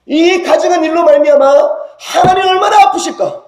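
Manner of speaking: high, loud preaching cry; rising, fervent preacher's shout; impassioned shout. Real, not acted. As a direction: cry out God's pain to the congregation. Preacher's climax, crying out.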